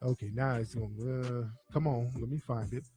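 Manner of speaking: low, soft talk to oneself; muttering while searching. Real, not acted. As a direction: mumble along as you hunt for something.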